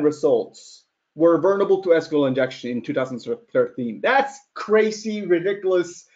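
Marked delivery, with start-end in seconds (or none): none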